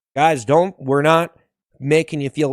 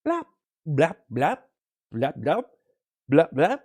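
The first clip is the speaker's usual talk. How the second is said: short popping blaps; playful little blips; bubble noises. Pretend. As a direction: pop out quick separate blap sounds. Pretending to be a bubble.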